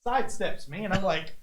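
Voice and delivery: ordinary talk, nothing marked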